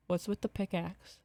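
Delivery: slow, wary question; asked slowly. A narrator's reading. Merrill asks Dan about the pickaxe, slow and wary. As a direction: draw the words out carefully. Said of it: "she asked slowly".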